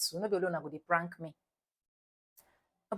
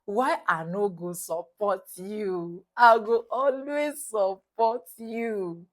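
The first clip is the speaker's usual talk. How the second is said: husband; 8 dB louder, slower than usual. Retold or imitated. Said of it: "He said".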